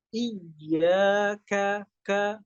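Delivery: "Iyyaka" drawn out with a thin, spread ka; smiling "ka". Shown as a demonstration. Teacher models thin kaf, smiling "Iyyaka, ka". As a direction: smile as you say "ka". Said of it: "ka-nya juga dengan tersenyum".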